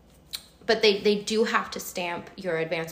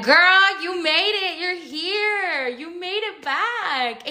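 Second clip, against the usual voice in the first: high, elated cheer, drawn out at the end; celebratory self-congratulation; 10 semitones higher, 3 dB louder, much slower than usual. Retold or imitated. Cheering herself after getting back in, high elated voice. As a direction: sing out the good news in a high voice.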